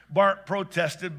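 usual speaking voice

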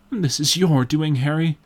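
bitter reproach to a friend. A narrator's reading. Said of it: "said the painter bitterly".

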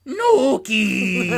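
mock cry, name held long; Miko. Miko answers his brother across continents, hammed-up call. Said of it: "And then Miko goes".

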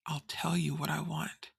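soft gravelly menace. Retold or imitated. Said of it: "heard a gravelly voice say".

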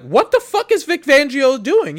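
high, loud mock outburst of disbelief; outraged quote. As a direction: imitate an exasperated player exploding. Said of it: "Two is like".

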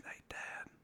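soft unvoiced whisper, retelling the son; hushed in the dark, breath only; a small boy's whisper at night. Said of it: "and he whispered".

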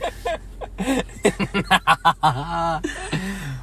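long, helpless laugh; laughter. Real, not acted. Host joking about the friend's draft night, long laughing fit. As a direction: laugh hard at your own joke.

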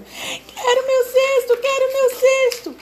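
very high, loud whining monkey cry; hammed-up squeaky monkey wail. A narrator's reading. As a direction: sob like a small monkey who lost everything. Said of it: "gemendo e chorando".

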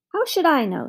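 high, irritable retort; snappish. A narrator's reading. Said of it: "snapped the farmer".

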